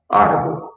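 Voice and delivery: "Árbol" repeated high and crisp, rolled r; repeated word. Shown as a demonstration. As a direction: repeat "Árbol" sharply for the class. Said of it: "ramo de árbol".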